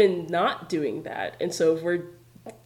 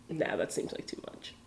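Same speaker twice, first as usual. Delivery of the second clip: whispered aside; 7 semitones lower, 12 dB quieter than usual. Retold or imitated. Inner thought quoted.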